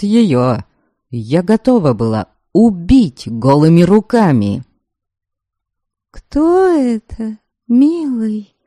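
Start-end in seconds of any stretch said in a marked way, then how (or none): 6.1-8.5 slow, high, coy and affected; languid simpering voice. Acted. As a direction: purr it out like a spoiled flirt. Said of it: "Томным, жеманным голоском".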